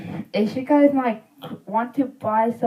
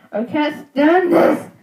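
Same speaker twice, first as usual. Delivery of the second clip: exasperated cry, higher and slowed; frustrated outburst; 6 semitones higher than usual. Real, not acted. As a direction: blurt out the frustration. Building frustration.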